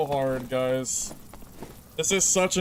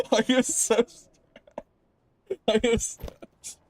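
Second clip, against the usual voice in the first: laughing through stress; nervous laughter; 7 semitones higher than usual. Real, not acted. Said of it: "I'm stressed".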